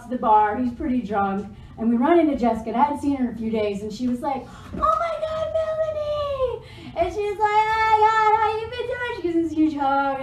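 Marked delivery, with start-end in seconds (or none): 4.7-6.7 very high, drawn-out mock squeal; gushing greeting. Retelling friend's gushing greeting in bar. 7.7-9.2 high, singsong mock delight; gushing greeting. Retelling friend's gushing greeting.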